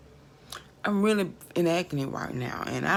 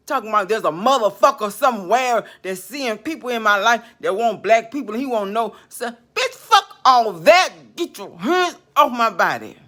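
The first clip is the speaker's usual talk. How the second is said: angry rant; 2 semitones higher, 5 dB louder than usual. Real, not acted. Raging at the spirit about the man, loud angry rant. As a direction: tear into it, furious.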